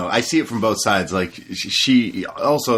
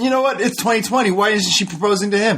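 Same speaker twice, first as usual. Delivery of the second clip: much higher, put-on petty voice with a laugh; mock whiny bride; 10 semitones higher than usual. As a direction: parody the spoiled fiancée. Mocking the sobbing bride.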